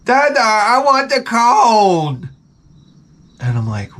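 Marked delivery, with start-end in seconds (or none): 0.0-2.3 put-on high, drawn-out kid voice; slow, high-pitched mock whine; whining young son. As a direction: imitate a child begging his dad. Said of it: "and my son's like".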